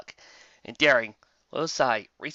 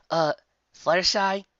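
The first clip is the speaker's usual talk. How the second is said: a touch higher, puzzled call; a hint of Twilight; lightly voiced Twilight; 7 semitones higher, 5 dB louder than usual. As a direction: call her friend mildly puzzled. Twilight calls her friend.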